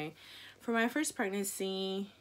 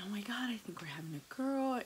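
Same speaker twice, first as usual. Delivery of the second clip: excited unvoiced whisper; confiding a hunch to her husband; whispered secret; 4 semitones higher, 3 dB quieter than usual. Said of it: "telling my husband".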